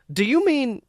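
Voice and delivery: a hint of a worried scientist; Rugert asking. A narrator's reading. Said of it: "asked Rugert".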